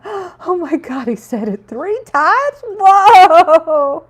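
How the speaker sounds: high, slow gasping disbelief building to a loud whoa; breathless fan moment; retold excitement. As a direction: act out being starstruck all over again. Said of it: "I'm like".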